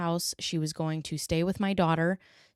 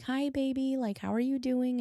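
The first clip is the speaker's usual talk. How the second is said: higher, softer coaxing; soft sweet voice; 8 semitones higher, 4 dB quieter than usual. Retold or imitated. Mother and grandmother soothing the toddler. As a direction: gently reassure the child.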